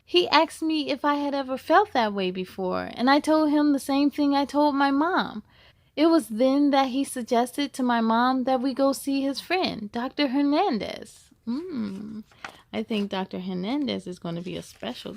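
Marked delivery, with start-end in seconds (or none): 0.0-11.3 put-on bright, steady read-aloud for kids; story narration. Reads picture book aloud.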